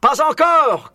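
cri aigu et fort; criant. A narrator's reading. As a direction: crier par-dessus le vacarme. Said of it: "cria Boggies". (Boggies répond en criant, très aigu)

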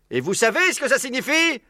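very high-pitched leading question; rousing fox orator. Acted. Maître Renard to the assembled animals, orating. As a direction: ask it to build anticipation.

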